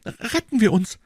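high, loud urging to flee; startled young lady pleading to get away; alarmed, voice pitched right up; frightened. A narrator's reading. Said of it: "entgegnete Madame Bonacieux erschreckt".

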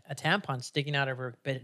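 usual speaking voice

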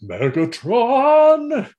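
high, slow stunned question; mock gasp of shock at the name; shocked Megatron. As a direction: draw the name out in disbelief. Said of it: "he's like".

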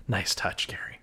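breathy whispered aside, no voice at all; hushed teasing thanks. Real, not acted. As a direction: whisper it as a playful thank-you.

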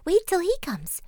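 pleading small-goat voice, often high; frightened little goat. A narrator's reading. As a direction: talk the troll out of it as a little goat. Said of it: "he was very much frightened".

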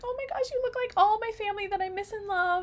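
high, gushing self-quote; high-pitched retold gush of affection; gushing delight. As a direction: coo it like meeting long-lost family. Retelling meeting her great uncle.

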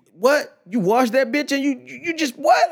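high, rising "what?" of outraged disbelief; hammed-up incredulous sputtering; mock disbelief. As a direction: act scandalized, voice climbing sharply on each question. Mock disbelief at washed skillet.

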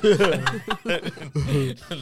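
loud high laugh; cracking up; burst of laughter. Real, not acted.

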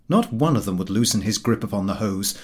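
usual speaking voice

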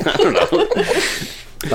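drawn out through high laughter; laughing. Real, not acted. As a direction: giggle the words out slowly. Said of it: "Who's laughing?".

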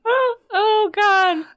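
high squealing oh god; delighted squeal. Real, not acted. Still laughing at squeaky voice.

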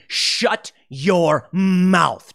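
very slow, drawn-out dismissive order; mock Trump command. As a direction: stretch every word like a boss shutting people up. Trump silencing his critics.

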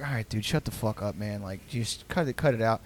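soft, low, annoyed mutter; muttered brush-off. Retold or imitated. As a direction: quietly tell him to drop it. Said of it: "we'd all be like".

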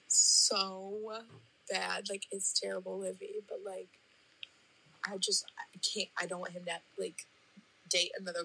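breathy, choked whisper of hurt; barely gets the words out, upset. Real, not acted. Caller confessing boyfriend cheated, whispering upset.

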